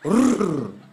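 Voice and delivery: loud rolled rrr like nails on glass; scratching noise. Retold or imitated. Father scratching the bedroom window to scare the boy, rolled scratching noise. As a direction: imitate a scraping on the window.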